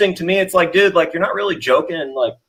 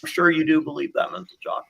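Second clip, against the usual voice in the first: breathy aside under the breath; whisper; 4 semitones lower, 10 dB quieter than usual. Real, not acted. Host whispering a dismissive aside to chat. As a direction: whisper it past the mic, dismissive.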